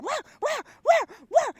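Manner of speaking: high yappy fake barking; mock dog bark. Sub imitating a dog, high yappy barks. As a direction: yap like a tiny dog. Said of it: "He's like".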